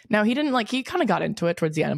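usual speaking voice

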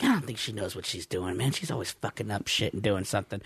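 low, soft griping to himself; complaining about the supervisor under his breath; grumbling under breath. Retold or imitated. Complaining to self at dock.

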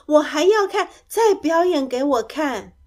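high, loud delighted demand; happy begging. A narrator's reading. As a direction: beg eagerly for an encore. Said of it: "木奇开心的直拍手". (开心地大声央求)

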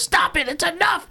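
very high-pitched imitation of a girl pleading; mock desperate protest. Acts out bullied girl's protest.

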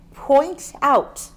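"point out" punched loud and separate; emphatic POINT OUT. Real, not acted. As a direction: hit "point" and "out" as two deliberate beats. Stressed words in quoted verse.

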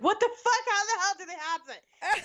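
high mock outrage; shocked outrage. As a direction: squeal in disbelief. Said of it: "So I'm like".

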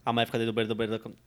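rushed tongue twister, stumbling at the end; three times fast. Shown as a demonstration. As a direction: gabble it fast and trip over it. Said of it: "fala três vezes rápido".

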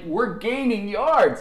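high, loud, drawn-out exclamation; mock protest. Kid answering his team about the penalties, incredulous holler.

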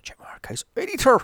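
conspiratorial aside. Real, not acted. Aside whisper then burst.

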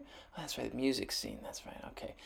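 near-silent murmur talking himself down; very soft, almost voiceless reassurance; hushed self-reassurance. Pretend. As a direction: mutter it under the breath. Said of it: "self-answer hushed".

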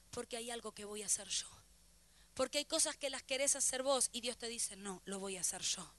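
soft, low, reflective sermon voice; hushed preaching. Real, not acted. Preacher on waiting outside for God's answer, hushed and low.

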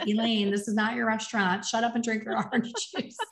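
quickening, giggly self-reproach; laughing self-scolding. Retold or imitated. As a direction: scold yourself playfully, laughing by the end. Retold self-scolding, breaking into laughter.

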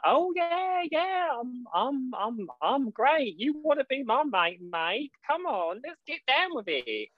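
hammed-up eager fresher, very high; mock eager fresher. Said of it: "you're like".

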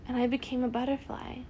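ordinary delivery

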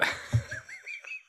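silent wheezing laugh; real laugh under the breath; laughing hard at the jacket joke; breathy high laugh. Real, not acted.

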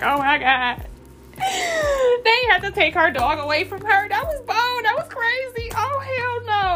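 hammed-up, tearful, very high outrage; mock tearful reaction. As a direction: play up a movie-watcher crying over a dog. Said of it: "I could watch a movie and be like".

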